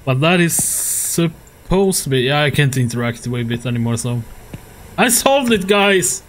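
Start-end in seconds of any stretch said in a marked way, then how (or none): 5.0-6.2 high, triumphant announcement. Real, not acted. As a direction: crow over a hard-won success. Player announces solving the puzzle.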